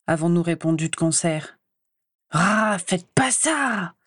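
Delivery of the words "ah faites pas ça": long drawn-out, higher-pitched whine; plaintive. A narrator's reading. Ron begging his two friends to stop, plaintive. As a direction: stretch it into a plaintive moan. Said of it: "a fait Ron plaintivement".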